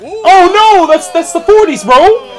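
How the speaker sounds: high, loud laughing protest; excited outburst. Real, not acted. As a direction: blurt it out in disbelief. Correcting a wrong answer.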